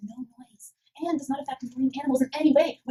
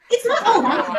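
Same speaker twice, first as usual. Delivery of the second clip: higher, louder protest; raised reply; 9 semitones higher, 8 dB louder than usual. Acted. Student answering the policeman with a loud, high protest. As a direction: push back loudly on the interruption.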